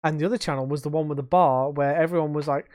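ordinary talk

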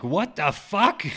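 loud, high, drawn-out what the fuck; shocked shout. Retold or imitated. Dad blurts out in shock at the news, a loud shout.

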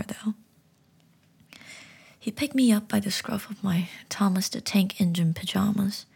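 ordinary delivery

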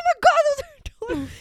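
high fast laughing squeal, imitating her friend; hammed-up excited shriek; mock frantic squeal. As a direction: squeal it like someone who just spotted her lost donuts. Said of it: "She's like".

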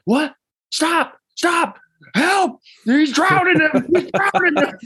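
very high cries for help, breaking into laughter; mock panic. Larry mistaking a baptism for drowning, mock panicked cries. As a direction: imitate a frantic bystander, squealing way up high.

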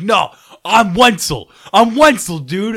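put-on loud, slow, high drunken claim; drunk proclaiming.

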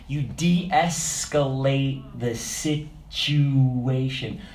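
very slow, measured words; slow de-escalation. Shown as a demonstration. Preacher showing how a cop calms things, slowly drawn out. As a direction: stretch it out syllable by syllable. Demonstrates calming things by lowered voice.

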